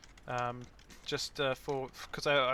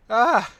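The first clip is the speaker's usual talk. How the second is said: pained cry; 9 semitones higher, 20 dB louder than usual. Real, not acted. Nose tickle agony.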